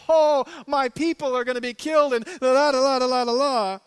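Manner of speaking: mocking a hysterical plea, loud and high; a hammed-up wail of panic; mock hysterics, then la la la; mock hissy fit. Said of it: "some hissy fit".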